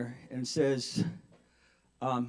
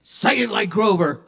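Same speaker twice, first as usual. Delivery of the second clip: loud, raised angry demand; impatient order; 5 semitones higher, 6 dB louder than usual. Acted. Cyclist to the pinned driver, impatient demand. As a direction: insist on it forcefully.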